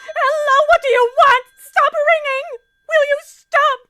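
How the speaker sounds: high, loud shrieks of terror; hysterical screaming. Acted. Terrified wife shrieking at the phone to stop ringing.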